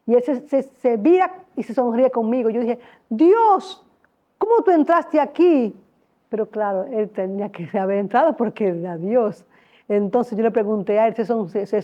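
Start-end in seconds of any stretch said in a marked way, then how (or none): 3.1-5.7 amazed question. Retold or imitated.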